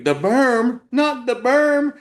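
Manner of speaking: high, loud squeal on berm; imitating the panic. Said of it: "You're like".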